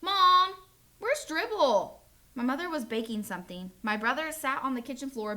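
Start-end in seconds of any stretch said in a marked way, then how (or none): 0.0-2.0 loud, high panicked call for Mom; hollering. Acted. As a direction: call out loudly across the apartment. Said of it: "kitchen and hollered".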